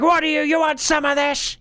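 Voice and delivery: high, lightly mimicked yell at the neighbour; imitating a mock tough guy challenge. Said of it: "pretending like he's yelling at his neighbor".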